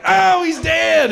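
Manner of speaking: high, loud mock cry; mock alarm. Kid play-wrestling behind mom in the store, mock alarm. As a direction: cry out slowly, high and hammed-up. Playing wrestling knockout to shoppers.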